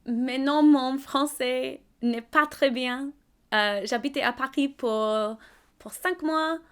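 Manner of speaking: put-on slow, halting French with an English accent. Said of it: "Your French is better than you say".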